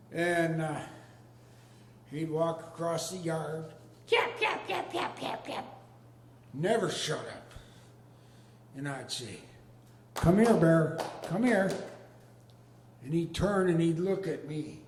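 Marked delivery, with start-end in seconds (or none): 4.1-5.7 much higher, yappy small-dog bark in words; yapping little dog. Retold or imitated. 10.1-11.7 louder call across the yard to a dog. Retold or imitated.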